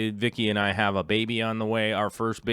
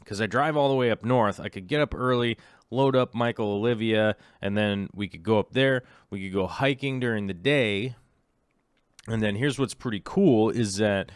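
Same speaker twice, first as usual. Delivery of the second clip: ordinary delivery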